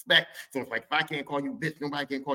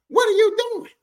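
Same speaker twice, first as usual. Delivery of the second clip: very high, loud squawk; acted falsetto objection; indignant boyfriend; 9 dB louder than usual. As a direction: screech it in sassy outrage. Uncle's boyfriend objects to intrusion.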